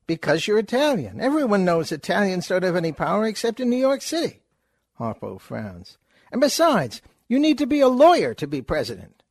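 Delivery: fast, high, loud wisecracking patter; Groucho wisecrack. Acted. Groucho lecturing Chico about the presidency, fast and loud. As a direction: rattle it off like a vaudeville con man.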